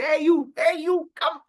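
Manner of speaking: put-on high, drunken hail to someone; playful drunk shout; drunken calling. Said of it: "he is drunk".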